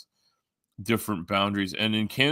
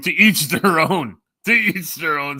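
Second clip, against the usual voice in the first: higher, louder, drawn-out proclamation; raised, stretched-out delivery; mock exclamation; 7 semitones higher, 7 dB louder than usual. Mock-grand proverb called out.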